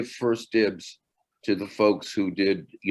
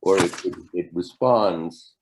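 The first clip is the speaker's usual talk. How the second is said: normal talking voice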